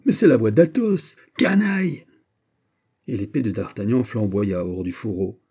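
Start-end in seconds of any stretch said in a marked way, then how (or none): 0.1-2.1 loud, high exclamation; startled outcry. A narrator's reading. D'Artagnan recognises his friend's voice under attack, crying out. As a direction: cry out in recognition, then outrage. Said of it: "s'écria D'Artagnan".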